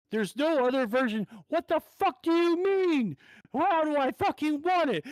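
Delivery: high, exasperated mock yell; retold angry screaming. Customer yelling at drive-thru worker, retold furious rant. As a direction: rant at her, pitch climbing to a strained peak. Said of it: "I'm fucking screaming at the top of my lungs".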